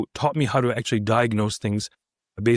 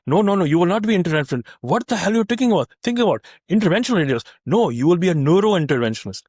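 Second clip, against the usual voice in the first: put-on high, emphatic Indian-accented scolding; 5 semitones higher, 3 dB louder than usual. Mentor scolding trainee over career choice, indignant Indian accent. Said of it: "In, in his Indian accent".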